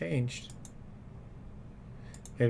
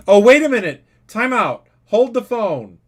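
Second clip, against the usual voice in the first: much higher, much louder sudden mock alarm, like an emergency announcement; 10 semitones higher, 14 dB louder than usual. Host bursts in on Kevin to announce the Dorco update, mock-alarmed shout. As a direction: stop everything and sound the alarm.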